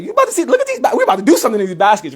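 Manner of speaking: high, excited pre-stunt hype; hyped up. Retold or imitated. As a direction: pitch leaps up then races on. Hyped up retelling before baskets.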